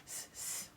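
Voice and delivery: "S" as a hissed sss; s sound. Shown as a demonstration. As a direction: hiss each "S". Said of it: "S is S".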